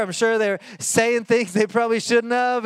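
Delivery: usual speaking voice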